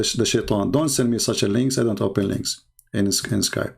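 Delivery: normal talking voice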